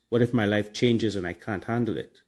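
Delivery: ordinary delivery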